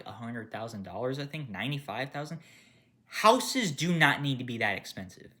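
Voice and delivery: ordinary delivery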